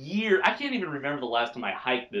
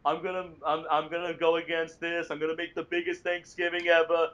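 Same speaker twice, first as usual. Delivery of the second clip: higher, blustering Trump voice; Donald Trump impression; 4 semitones higher than usual. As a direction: brag like a rally speaker. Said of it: "[name]' Donald Trump impression".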